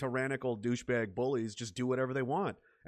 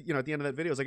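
ordinary delivery

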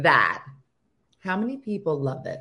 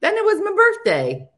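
high, louder, bright reveal; excited announcement; 13 semitones higher, 6 dB louder than usual. Real, not acted. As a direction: announce it like a treat. Announcing her birthday photo.

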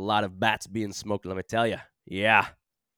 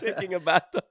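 mocking repeat; 9 semitones higher, 3 dB louder than usual.

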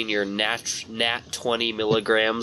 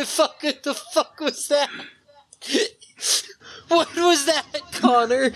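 high, laughing squeal of disbelief; slower than usual. Real, not acted. Shocked laughing reaction.

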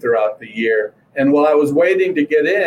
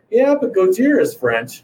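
higher, gotcha retort; teasing comeback; 6 semitones higher than usual. Retold or imitated. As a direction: needle him with the obvious fact. Dad's retold comeback to son.

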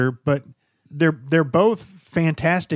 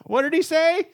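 put-on old man with hearing aid. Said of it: "he's like".

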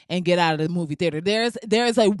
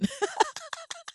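short squeaky laugh; high burst of laughter; 9 dB quieter than usual. Real, not acted. Laughing at the judgment-free podcast joke.

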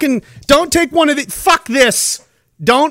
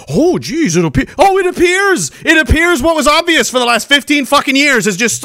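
mock surprise; 5 semitones higher than usual. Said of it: "You're acting like this is a disco".

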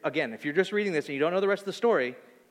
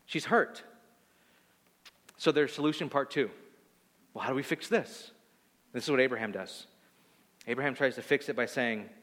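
ordinary talk, nothing marked

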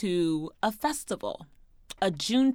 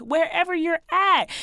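ordinary delivery

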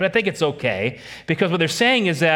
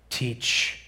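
whispered; 4 semitones lower, 6 dB quieter than usual. Acted. Pastor urging the single command.

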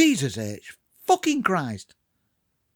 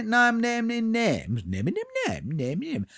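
nonsense babble; 4 semitones higher, 5 dB quieter than usual. Pretend. Mocks himself about naming names.

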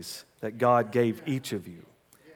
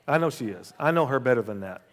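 ordinary talk, nothing marked

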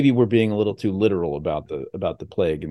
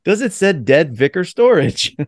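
higher, slower, mock theatrical; hammy stage actor; 7 semitones higher, 4 dB louder than usual. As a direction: play a pompous stage actor, drawn out. Mock medieval banter reply.

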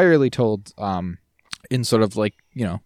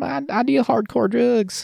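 high, slow Southern drawl; playful put-on Southern twang; 13 semitones higher, slower than usual. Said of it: "little southern twang".

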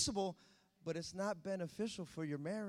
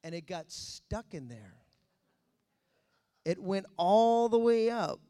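normal talking voice

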